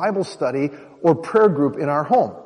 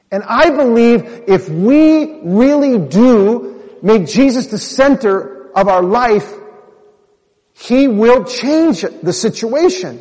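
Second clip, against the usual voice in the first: slow, fervent preacher's cadence, higher and louder; preaching; 6 semitones higher, 5 dB louder, slower than usual. Retold or imitated. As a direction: preach it with fire in her eyes. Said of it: "She was, like, preaching".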